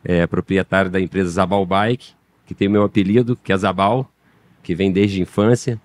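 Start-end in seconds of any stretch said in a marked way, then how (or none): none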